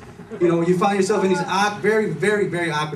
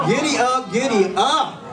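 higher, bouncy giddy up; mock rodeo cheer; 8 semitones higher, 3 dB louder, slower than usual. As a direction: call it like riding a horse. Riding the corpse joke.

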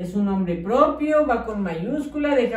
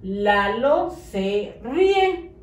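"Lalo se ríe" read very slowly, each word clear; slow careful reading; much slower than usual. Shown as a demonstration. Teacher modelling the correct sentence slowly. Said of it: "Ahora sí ya se entiende".

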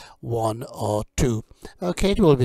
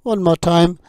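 vocabulary drill; 4 semitones higher, 6 dB louder than usual. Acted. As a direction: model each word for learners to repeat. Teacher drills kitchen vocabulary.

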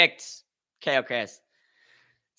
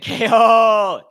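long, loud holler; gleeful shout; 7 semitones higher, 12 dB louder than usual. Real, not acted. Streamer jokes about the chair after knockouts, hollering.

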